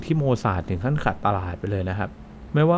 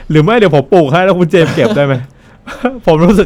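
loud and high through real laughter; cracking up at his own joke; laughing out a silly plan; 7 semitones higher, 9 dB louder than usual. Real, not acted.

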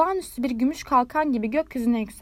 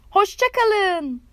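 calling out; 8 semitones higher, 4 dB louder, much slower than usual. A narrator's reading. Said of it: "diye bağırdı".

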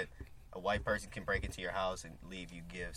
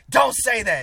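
high, loud scolding teacher voice; teacher scolding the kid, high and loud; mock angry teacher; 17 dB louder, slower than usual. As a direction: snap it like a shrill teacher. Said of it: "She was like".

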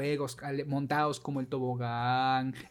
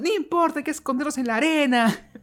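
put-on playful kid voice; 8 semitones higher, 3 dB louder than usual. Kids' game voices acted out.

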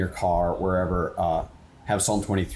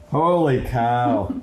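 slow, drawn-out amazed exclaim; awed; 2 semitones higher, 4 dB louder, much slower than usual. Real, not acted. Host reacting to the guest's song, slow awestruck exclaim. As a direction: let the awe stretch out slowly.

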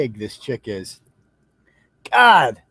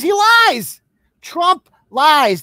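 higher, louder fake outrage; mock shock; 6 semitones higher, 4 dB louder, much slower than usual. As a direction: play up the scandal sarcastically. Mocking Biden voters' complaints.

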